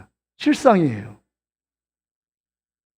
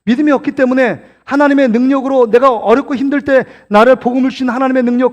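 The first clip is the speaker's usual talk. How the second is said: ordinary delivery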